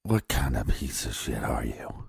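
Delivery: low, very soft, breath-only aside; scornful whisper. Acted. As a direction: whisper it as if to the suspect himself. Muttered scornful retort to absent suspect.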